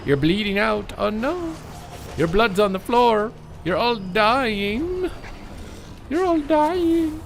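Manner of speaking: slow squeal climbing very high; mock gloating. Player gloating over bleeding enemy, high drawn-out mockery. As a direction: stretch the words out and climb into a squeaky falsetto.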